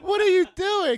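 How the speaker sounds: high, loud husband voice; mock outraged husband. Husband confronting his wife, high and loud.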